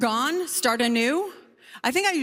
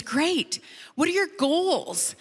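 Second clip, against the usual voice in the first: high, drawn-out coaching question; bubbly, slow and higher-pitched; perky coach; 5 semitones higher, slower than usual. Acted. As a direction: chirp it like an eager life coach. Perky coach opening the session.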